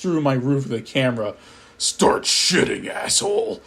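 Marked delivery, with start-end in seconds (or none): 1.8-3.6 deep voice. Acted. Eggman crashing through the roof to threaten the narrator, deep slow menace. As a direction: growl the order like a horror villain. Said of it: "in a deep, uncharacteristic voice".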